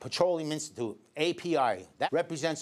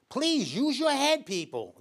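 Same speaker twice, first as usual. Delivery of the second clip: slow, high, pleading scold; exasperated plea; 7 semitones higher, slower than usual. Real, not acted. Scolding viewers about energy ads.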